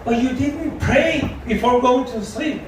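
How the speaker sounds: a hint of an earnest child reminding a grown-up; kid voice. Kid reminding him to pray.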